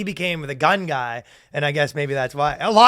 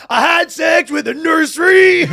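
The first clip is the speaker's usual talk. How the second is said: shouted lyric; 10 semitones higher, 7 dB louder than usual. Retold or imitated.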